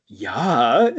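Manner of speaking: excited Swedish doctor yeah; Swedish accent. Retold or imitated. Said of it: "the doctor's like".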